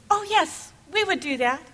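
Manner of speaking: put-on high, eager kids answering yes; eager children.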